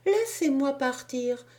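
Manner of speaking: put-on high, insistent child begging; a boy refusing to listen, pleading to go; child's plea. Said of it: "disait le futur ressuscité".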